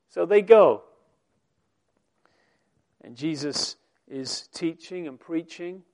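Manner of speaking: ordinary delivery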